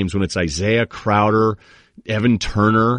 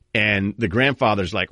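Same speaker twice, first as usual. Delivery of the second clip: usual speaking voice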